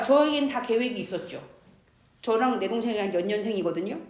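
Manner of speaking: ordinary talk, nothing marked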